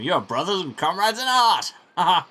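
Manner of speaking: loud, high, booming cheer with a laugh; hearty exclamation. Acted. Baron cheering the brothers as comrades, loud and jovial. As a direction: boom it out jovially.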